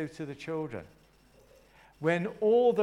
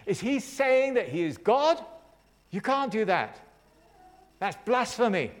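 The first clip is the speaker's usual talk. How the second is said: higher, indignant disbelief; absolutely shocked; 6 semitones higher, 5 dB louder than usual. Acted. Religious teachers reacting to Jesus, shocked and outraged. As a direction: challenge it in rising outrage, pitched high. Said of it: "they were just absolutely shocked".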